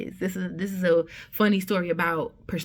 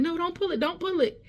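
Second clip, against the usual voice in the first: urgent warning; 10 semitones higher than usual. Retold or imitated. Respiratory tech stops her pulling.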